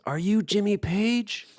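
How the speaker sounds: high, slow mock holler; imitated calling out. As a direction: call across the water, retold. Edwin calling to man on boat.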